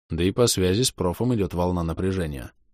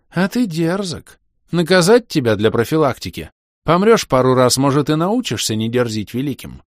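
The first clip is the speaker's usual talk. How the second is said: threatening; 7 semitones higher, 6 dB louder than usual. Acted. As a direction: threaten haughtily. Шир грозит. (надменная угроза)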